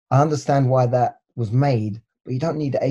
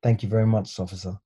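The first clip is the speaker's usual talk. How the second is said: put-on lower, softer polite English accent; best English voice; 4 semitones lower, 5 dB quieter than usual. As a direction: thank the officer in careful English. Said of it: "So I put my best English voice".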